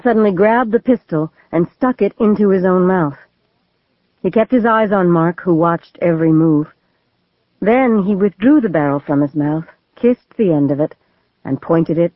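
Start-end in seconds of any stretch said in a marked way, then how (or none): none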